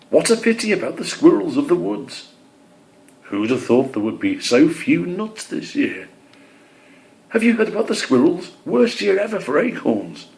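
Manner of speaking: high, chattering bird voices; gossiping birds. Acted.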